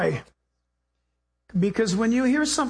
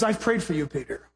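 tender Jesus; 6 semitones lower, 3 dB quieter than usual. Retold or imitated. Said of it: "And then Jesus says".

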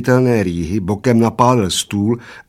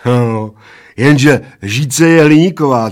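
mildly triumphant, raised and loud; 4 semitones higher, 7 dB louder, slower than usual. A narrator's reading. Bald man winning the argument with the little one, triumphant. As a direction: score the point with relish. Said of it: "triumfoval Plešatec".

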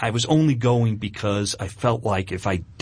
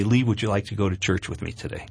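gentle invitation; 6 semitones lower, 4 dB quieter than usual. Retold or imitated. Wife gently asking her hungover husband to church, soft and low. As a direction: lower and softer, a gentle coaxing ask. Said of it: "she said very gently to me".

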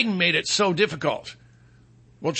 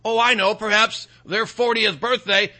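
usual speaking voice

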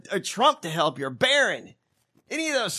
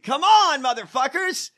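loud, higher cry of frustration; exasperated shout; 7 semitones higher, 5 dB louder than usual. Real, not acted. Host shouting in disbelief at a botched scheme, exasperated. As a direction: yell it at the whole sorry plot. Frustrated at anticlimactic story ending.

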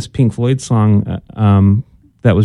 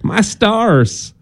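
higher, mock southern lady; a hammed-up Southern "My stars"; southern accent; 7 semitones higher than usual. Cohost showing how it's said, southern accent. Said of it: "you would only say that with a southern accent".

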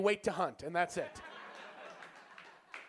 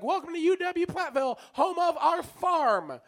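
proud promo voice; 8 semitones higher, 4 dB louder than usual. Retold or imitated. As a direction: sell the farm like a proud slogan.

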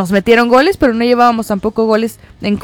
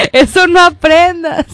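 laughing scolding; 6 semitones higher than usual. Retold or imitated. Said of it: "le dije".

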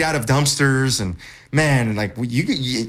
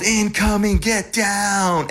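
retold yell; 8 semitones higher, much slower than usual. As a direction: shout the warning like incoming fire. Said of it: "I yelled".

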